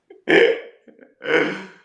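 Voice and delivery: loud, high cackle; real laughter. Real, not acted. Joker cracking up after voicing a wasp, loud laugh. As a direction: burst out laughing.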